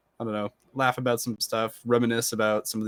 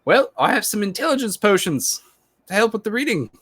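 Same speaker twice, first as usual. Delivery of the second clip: high-pitched, louder playful character voice; in-character offer; 11 semitones higher, 4 dB louder, faster than usual. Acted. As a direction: pipe up high with a helpful offer. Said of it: "and I go".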